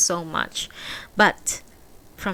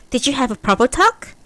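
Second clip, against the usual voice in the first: pressing; 6 semitones higher, 8 dB louder than usual. A narrator's reading. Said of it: "Fumiko pressed".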